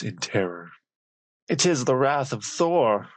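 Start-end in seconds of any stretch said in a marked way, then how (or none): 1.5-3.1 higher, fearful cry; a frightened trickster crying out in panic; crying out in dread. A narrator's reading. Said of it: "cried Loki, gasping with dread".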